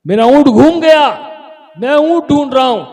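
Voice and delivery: very loud, high call; acted far-carrying shout. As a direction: holler it down from the rooftop. Camel man calls down from roof.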